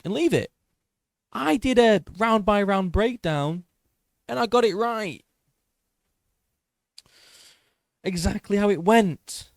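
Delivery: boastful excitement. Real, not acted. Creator brags to viewers his breakdown was right, loud and high. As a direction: crow about being right. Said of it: "stop tooting my own horn".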